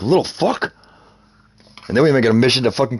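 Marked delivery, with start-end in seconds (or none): 0.0-0.7 higher, pinched mock curse; mock outrage. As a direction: spit it out in mock disbelief. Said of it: "I'm like".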